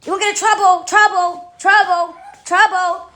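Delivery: mocking warning. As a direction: chant it like a taunting chorus of scolds. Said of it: "and then they say".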